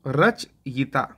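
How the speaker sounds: रचयिता said slowly with clear य; slow careful pronunciation. Shown as a demonstration. Teacher answering his own question, slowly saying रचयिता. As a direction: stretch रचयिता out for the class. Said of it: "क्या होता है?".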